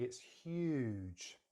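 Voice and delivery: "huge" drawn out long; emphatic stretch. Shown as a demonstration. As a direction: stress and lengthen "huge". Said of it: "I wanna emphasize".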